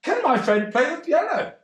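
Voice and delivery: high, cheeky mock voice; mate asking barman. Mate asks barman if friend can play, high cheeky voice. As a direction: pipe it up like a cheeky lad.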